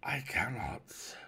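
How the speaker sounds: slow, hushed whispery reply; drawn-out ghostly refusal; mysterious unseen stranger. Acted. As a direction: whisper it out slowly from the dark. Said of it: "replied the voice".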